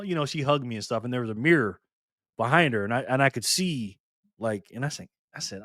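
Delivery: normal talking voice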